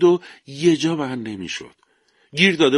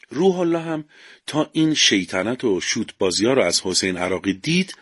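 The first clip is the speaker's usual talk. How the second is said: ordinary delivery, nothing marked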